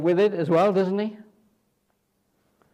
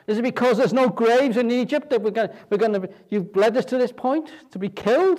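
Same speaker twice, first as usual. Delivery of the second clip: higher, louder mock whine of the crowd; whining complainers; 6 semitones higher, 5 dB louder than usual. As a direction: mimic the grumbling people, a bit louder. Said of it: "they start going on about".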